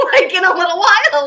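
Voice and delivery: laughing through the words. Real, not acted. Telling viewers about the haircut, laughing. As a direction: giggle it out, pitch jumping high.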